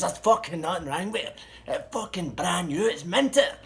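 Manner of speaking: high, loud, indignant acted protest; raging. Seller insists his car has nothing wrong, raging. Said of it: "he was fucking raging".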